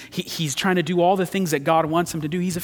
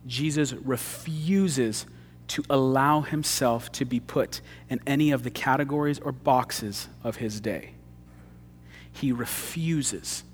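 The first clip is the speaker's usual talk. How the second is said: slow, much softer, weighty; slow solemn emphasis; 2 semitones lower, 5 dB quieter, slower than usual. Acted. Preacher stressing that Jesus refuses every label, slow and soft. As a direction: let it sink in, quietly.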